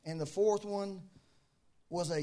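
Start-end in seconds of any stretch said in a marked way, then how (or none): none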